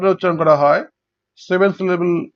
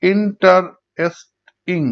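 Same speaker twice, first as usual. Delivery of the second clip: Interesting slowly in four separate beats; syllable by syllable; much slower than usual. Shown as a demonstration. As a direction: pause between in, tar, est, ing in interesting. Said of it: "চার ভাগে ভাগ হচ্ছে".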